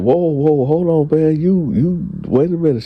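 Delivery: high-pitched, put-the-brakes-on objection; retold startled protest. Man stalls the woman's sudden offer, startled.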